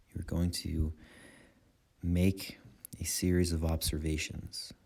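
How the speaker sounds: calm guiding voice in short phrases with long pauses; guided meditation. Acted. Meditation teacher guiding listeners' attention through breath and body, calm guided meditation. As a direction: lead them unhurriedly through the breath, leaving space after each phrase.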